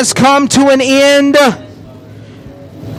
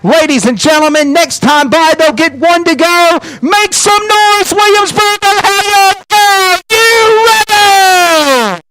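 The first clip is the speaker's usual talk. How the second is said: rising crowd-hype call, higher and higher; dueling hype announcers; 8 semitones higher, slower than usual. Real, not acted. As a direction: pump up the crowd, drawn out. Announcer hyping crowd before green flag.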